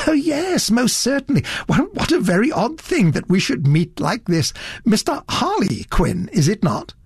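a hint of a fussy elderly gent, curious; fussy gentleman. Satterthwaite delighted surprise.

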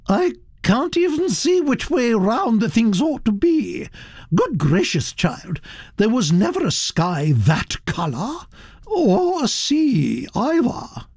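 put-on high, gossipy old aristocrat voice, pitched well up; elderly Duchess. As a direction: chatter on like a grand old lady. Duchess scolds paintings.

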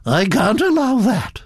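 high-pitched grande dame decree; lofty Duchess disapproval; imperious Duchess. Acted. Duchess decides to intervene.

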